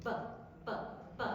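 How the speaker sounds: "B" popped three times on the lips; plosive b demo. Shown as a demonstration. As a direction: burst each "b" through closed lips. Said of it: "explode through".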